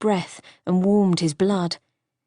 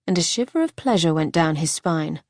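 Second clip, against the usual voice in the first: plain reading voice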